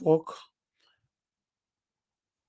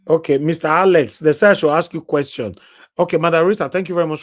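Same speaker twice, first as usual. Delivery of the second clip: loud, higher announcing voice; loud call; 5 semitones higher, 8 dB louder than usual. Real, not acted. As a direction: call the next caller out loudly. Host calling callers, loud.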